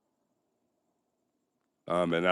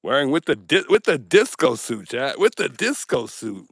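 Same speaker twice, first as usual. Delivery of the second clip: excited; 4 semitones higher, 7 dB louder than usual. Real, not acted. Excited about disco suit.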